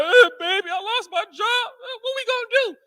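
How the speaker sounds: high, half-crying whine to his wife; mock whiny husband. Husband tells wife he lost his job, mock crying whine. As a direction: play the helpless husband begging, voice cracking up high.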